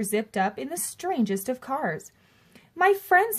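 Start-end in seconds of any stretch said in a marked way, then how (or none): 2.7-3.4 a high, bright salesman's greeting; clear keen announcement. A narrator's reading. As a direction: call out to the crowd like a showman. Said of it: "he announced in a voice clear and keen".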